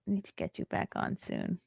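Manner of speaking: soft whisper; hushed whisper; whispered aside. Real, not acted. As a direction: murmur it privately. Quiet aside to chatter.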